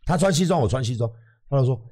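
ordinary talk

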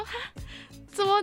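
ordinary talk, nothing marked

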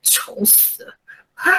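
mock disgust, breathed out soft. The guide sneering at Ashtar's looks, soft mock disgust. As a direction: spit it out in disgust, barely voiced. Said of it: "露出一副很厌恶的样子".

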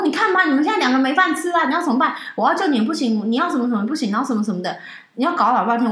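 a hint of the furious sister, easing off; lightly imitated sister's lecture, fading; sister scolding. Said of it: "然后说".

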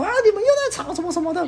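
very high, fast imitation of a yelling neighbor; mock yelling neighbor. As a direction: mimic the neighbor shouting over the fence. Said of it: "对着我们家破口大骂".

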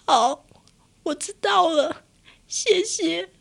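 holding back tears. Retold or imitated. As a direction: swallow the disappointment politely. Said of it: "然后我就眼睛含着泪". (含着泪、轻声慢慢说)

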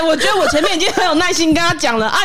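high-pitched, worked-up complaint; exasperated protest. Acted. Groomer who explained patiently to a deaf-eared customer, exasperated. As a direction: protest as if patience has run out.

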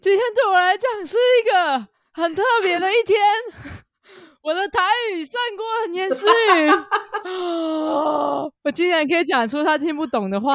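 high, loud gleeful bragging through laughter; excited. Real, not acted. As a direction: crow with delight, laughing. Bragging about Taiwanese, laughing. (得意地边笑边炫耀)

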